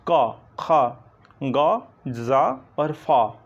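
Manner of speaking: क़, ख़, ग़, ज़, फ़ said slowly from the throat; nukta sounds. Shown as a demonstration. Teacher sounding out nukta letters to students, slow and deliberate. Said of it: "कंठ के नीचे से बोले जाएंगे".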